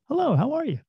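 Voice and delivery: high, sing-song shop greeting; bright hello. Retold or imitated. To a stranger in the store, a high bright hello. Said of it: "I walk right up by them and go".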